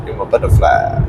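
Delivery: very low, crooning lover voice; deep voice. Pretend. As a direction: drop the voice way down, seductive. Mock seductive line.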